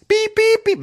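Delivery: very high, loud electronic-style beeps; shrieked alarm tone imitation; imitated door beeping. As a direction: mimic a piercing warning beep. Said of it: "Es hat total gepiept".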